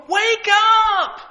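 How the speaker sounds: sudden high shout; short, high preacher's cry; wake-up shout. Real, not acted. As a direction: jolt the church awake. Preacher rebukes congregation.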